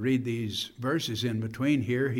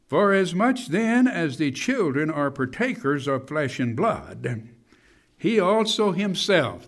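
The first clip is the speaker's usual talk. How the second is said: raised, ringing read-aloud of scripture; 4 semitones higher, 6 dB louder than usual. Real, not acted. Preacher reading Hebrews to the congregation, proclaiming scripture. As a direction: proclaim the verse with emphatic peaks.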